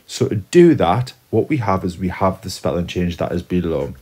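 ordinary talk

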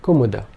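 Kumuda with three short vowels; Kumuda, quick and clipped; all vowels short. Shown as a demonstration. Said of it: "all three vowel sounds being short".

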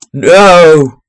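sharp, loud protest; loud outraged no. Real, not acted. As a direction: shout it in outrage. Reacting to a spoiler.

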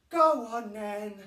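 slow, sighing give-in; a weary sigh from a tired mum. A narrator's reading. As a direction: let the breath out as you give in. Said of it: "sighed Missus Large".

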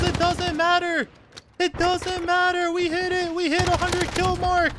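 very high, strained squeal of urgency; desperate high-pitched exclaiming at the finish line; frantic excitement. Real, not acted. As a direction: wail every word up high, stretched out. Frantic chase of hundredth kill.

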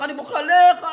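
very loud, high mock echo; mocking yell. Friend shouting the rabbi's line back at him, high. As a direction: throw his words back, shouting.

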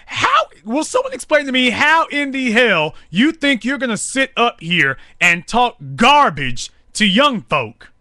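ordinary delivery

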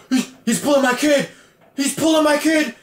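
high, hammed-up frantic father; mock panicked dad. Dad in the stands protesting his son's benching, mock panic. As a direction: squeal it like an outraged parent.

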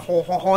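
low, soft guttural nasal French laugh; softly guttural mock French "ho, ho, ho". Shown as a demonstration. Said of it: "it's this low nasal, like, guttural".

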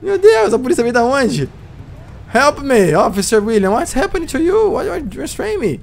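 high, distressed pleading; distressed protest. Acted. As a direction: plead against the arrest. Protesting arrest.

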